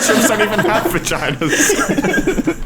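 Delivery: laughing. Real, not acted. Cracking up at the joke.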